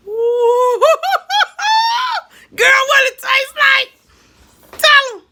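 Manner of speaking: high, loud, slow cackling through the words; shrieking laughter while asking her; laughing shout. Real, not acted. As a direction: crack up while yelling at her. Laughing at daughter's reaction.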